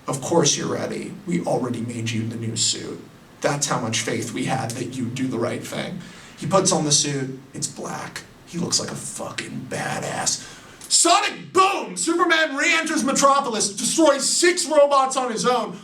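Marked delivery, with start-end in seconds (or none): 0.1-6.0 lower, softer, half-whispered dad voice; a hint of a gentle, reassuring father; fatherly reassurance. Pa Kent reassuring his son about the suit, low and soft. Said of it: "And Pa Kent says". 7.5-10.5 whispered in hushed awe; awed whisper. Acted. 10.9-15.8 hyped shout. Acted. Narrator hyping Superman's return, shouting high.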